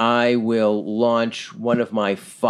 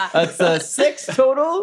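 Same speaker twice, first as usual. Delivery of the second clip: laughing high through the words; 13 semitones higher than usual. Real, not acted. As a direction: giggle through the roll result. Laughing after the throw.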